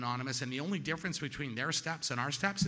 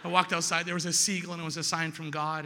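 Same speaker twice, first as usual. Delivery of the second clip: giddy excitement; 5 semitones higher, 7 dB louder than usual. Retold or imitated. Retelling spiritual high in treatment.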